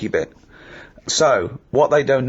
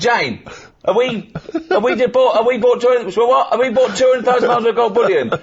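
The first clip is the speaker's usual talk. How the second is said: much higher, louder, laughing disbelief; incredulous call to wife; 12 semitones higher, 3 dB louder than usual. Retold or imitated. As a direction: call out to Jane in amused panic. Calling wife about bullion purchase.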